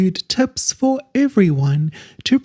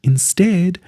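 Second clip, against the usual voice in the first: calm guided relaxation voice drawing out each instruction; guided meditation. Acted.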